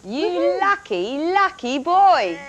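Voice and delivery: drawn-out, high, swooping delivery; teasing congratulation. Real, not acted. Presenter congratulating the PC winner, teasing and drawn-out.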